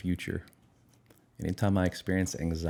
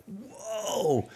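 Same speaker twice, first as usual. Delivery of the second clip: much higher, breathy soft whoa; amazed whoa; 12 semitones higher, 4 dB quieter than usual. Retold or imitated. Speaker stunned his depression is leaving, soft high whoa. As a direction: let it fall out of you in amazement.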